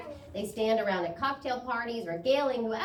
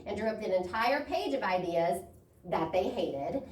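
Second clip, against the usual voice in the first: ordinary talk, nothing marked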